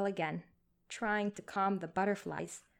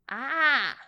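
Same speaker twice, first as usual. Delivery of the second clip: put-on pleased old man; 6 semitones higher, 9 dB louder than usual. A narrator's reading. Old voice reacting.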